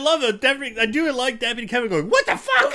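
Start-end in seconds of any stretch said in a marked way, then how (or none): 2.1-2.7 sudden very high, loud "what the fuck"; shocked outburst. Retold or imitated. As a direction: blurt it out in total disbelief. Friend voicing deputy's reaction.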